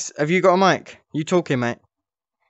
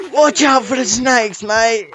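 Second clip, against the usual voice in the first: slow grandpa, pitched up; 4 semitones higher, slower than usual. Shown as a demonstration. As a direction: draw it out like grandpa warning a mate. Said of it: "A grandpa voice is more like".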